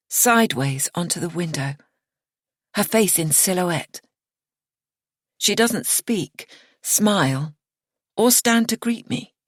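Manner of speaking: usual speaking voice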